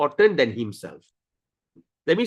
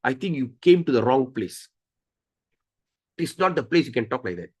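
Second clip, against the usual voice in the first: a hint of a lower, stern doorkeeper; stern guard; 5 semitones lower than usual. Vishnu's attendant turning the boy away, mildly stern. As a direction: politely shoo the boy away. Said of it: "Vishnu Dutta said".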